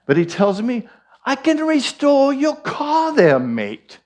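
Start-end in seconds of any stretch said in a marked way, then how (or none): 1.2-4.0 high, put-on English accent drifting into Irish; English accent, slipping Irish. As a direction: imitate the English neighbour, accent sliding to Irish. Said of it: "I get into the Irish".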